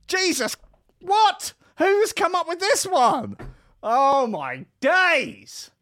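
very high, loud, slow shriek; shrieking disbelief. Acted. Reacting to the headline, very high, loud and slow. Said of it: "You're just like".

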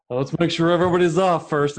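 lightly acted bus driver; imagined bus driver. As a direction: playfully announce it like a driver. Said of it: "I think it would have been like".

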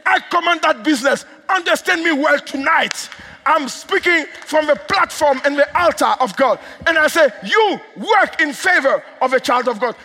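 high, loud declarations with stretched key words; full-force commanding prayer. Real, not acted. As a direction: decree it forcefully from the pulpit.

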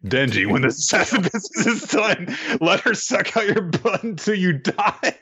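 high, giggling delivery; can't keep a straight face; laughing through it. Retold or imitated. Podcaster retelling Aki's line, laughing and high.

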